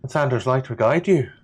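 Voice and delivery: a light hint of an Irish lilt; a touch of a brogue; lightly voiced merchant. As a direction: bless them in a faintly Irish voice. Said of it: "Is she Irish?".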